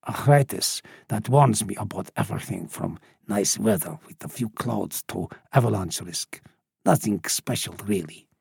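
put-on low, soft, offhand old man; old smuggler. A narrator's reading. Old smuggler telling Nilly his special gifts, low and understated. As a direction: list it off low and modest.

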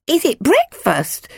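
put-on very high, slow toddler voice; hopeful little boy piping up about food; small boy. A narrator's reading. Three-year-old Jack asks about food, in a high child's voice.

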